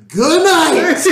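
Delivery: high, loud, bright sign-off cry; triumphant exit. Retold or imitated. George shouts good night as he exits after a good joke. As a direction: belt it out and leave on a high. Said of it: "he does the".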